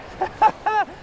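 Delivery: quick high giggle of delight; high delighted laugh. Real, not acted. Laughing at big fish.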